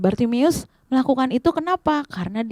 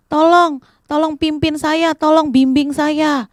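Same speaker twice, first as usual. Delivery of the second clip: high, pleading call; calling for help; 6 semitones higher, slower than usual. Acted. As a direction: beg to be led, voice raised. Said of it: "Bartimeus semakin lagi teriak". (seruan memohon bernada tinggi)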